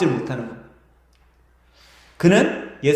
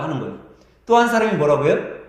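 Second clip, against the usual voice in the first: ordinary talk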